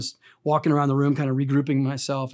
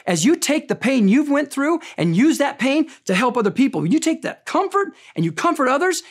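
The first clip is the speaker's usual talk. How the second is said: high, ringing preacher's voice, pushing every line; impassioned preaching; 9 semitones higher, 3 dB louder than usual. Acted. Pastor urging his congregation that pain has purpose, fervent preaching. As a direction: preach it full force to the room.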